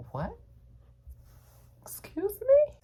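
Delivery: a very high, incredulous excuse me; shocked disbelief. Retold or imitated. Worker finding the crew vanished, high shocked squeal. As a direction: squeal it like you cannot believe it. Finds coworkers all gone.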